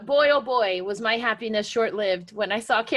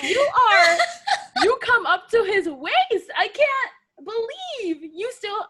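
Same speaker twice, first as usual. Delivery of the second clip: high, loud, drawn-out disbelief; half-laughing, exasperated telling-off; incredulous mock scolding; 11 semitones higher, 5 dB louder, slower than usual. Scolding the little wrestler in absentia, high mock outrage.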